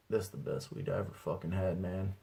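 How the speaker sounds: soft, lazy contentment; stoned bliss. Retold or imitated. Stoned in the room, soft lazy bliss. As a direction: sink into it, fully baked.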